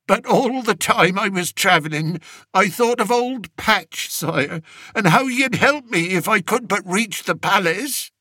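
a humble peasant begging the king; old country shepherd. Acted. Old shepherd telling the king of his journey, rustic pleading voice.

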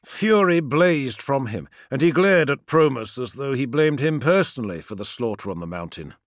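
ordinary talk, nothing marked

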